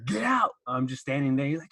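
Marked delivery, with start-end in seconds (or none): 0.0-0.5 high-pitched mock yell; strained mock order, pitched way up; imitated freak-out. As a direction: imitate an instructor losing it, without full volume. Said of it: "He's, like, freaking out".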